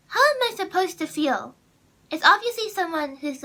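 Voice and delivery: retold indignant reaction; indignant disbelief. Vlogger recalling her reaction to a hurtful email, indignant.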